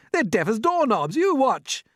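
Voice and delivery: high, loud bragging scorn; cried out. A narrator's reading. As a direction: crow it boldly. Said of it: "he cried".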